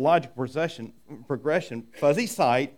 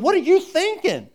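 much higher, louder exasperated cry; exasperated question; 13 semitones higher, 8 dB louder than usual. Retold or imitated. Jesus answering the confused disciples, high exasperated cry. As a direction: fling it back in disbelief. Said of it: "And he's going".

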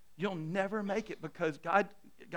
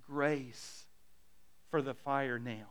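put-on tender old saint; 5 semitones lower, 8 dB quieter than usual. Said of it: "He says".

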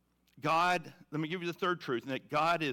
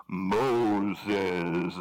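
deep, loud, booming call of a name; voice from the bush; 8 semitones lower, 7 dB louder than usual. Acted. God calling Moses out of the burning bush, deep and booming.

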